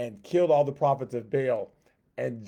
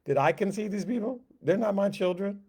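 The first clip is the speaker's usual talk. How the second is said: indignant protest; 7 semitones higher than usual. Retold or imitated. Moses protesting to God.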